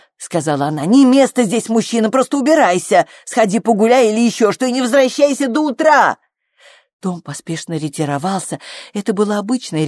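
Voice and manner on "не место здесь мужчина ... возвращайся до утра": high, loud impatient ordering; angry dismissal. A narrator's reading. Flo sending frightened Tom away until morning, angry dismissal. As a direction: shoo him out, rising to the end.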